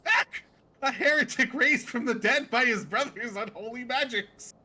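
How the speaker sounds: theatrical denunciation of a heretic; outraged zealous seer. Acted. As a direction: denounce it with hammed-up horror. Zealous seer denounces the zombie.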